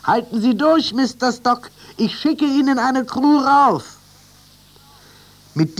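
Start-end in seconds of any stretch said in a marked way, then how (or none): 0.0-4.0 high, loud call upward; shouting. A narrator's reading. Captain calling up to Mister Stock, high loud shout. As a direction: yell encouragement up the mast. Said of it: "schrie der Kapitän".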